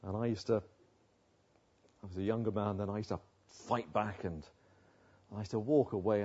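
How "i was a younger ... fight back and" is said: ordinary talk, nothing marked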